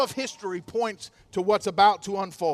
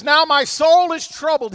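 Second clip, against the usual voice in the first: loud, higher anguished plea; anguished cry; 7 semitones higher, 9 dB louder than usual. Acted. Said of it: "He's in anguish".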